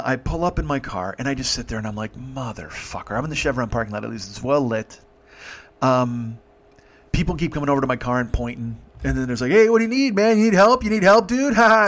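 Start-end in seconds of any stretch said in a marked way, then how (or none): 2.2-3.2 slow, low, quiet curse; drawn-out private swearing under the breath; muttered dismay. Retold or imitated. Muttered dismay sitting in car, retold. 9.5-11.9 loud, high mocking shout with laughter; imitating young dudes offering mock help; rowdy hecklers. Laughing crowd heckles him, acted.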